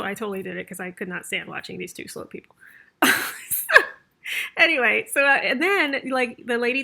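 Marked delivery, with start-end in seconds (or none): 3.0-3.9 a sudden, very high laugh; high burst of laughter. Real, not acted. Laughs at her own confession.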